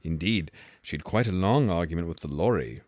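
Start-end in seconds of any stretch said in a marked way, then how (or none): none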